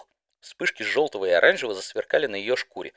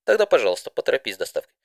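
plain reading voice

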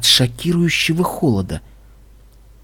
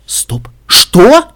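startled; 8 semitones higher, 7 dB louder than usual. A narrator's reading. Listener baffled at being heard, startled. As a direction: break off, then blurt in disbelief.

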